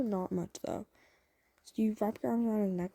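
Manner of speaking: ordinary talk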